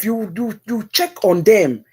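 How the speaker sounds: usual speaking voice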